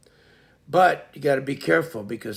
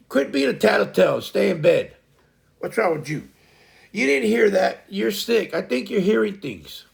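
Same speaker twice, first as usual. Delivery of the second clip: scolding; 7 semitones higher, 6 dB louder than usual. Real, not acted. Scolding someone off-mic.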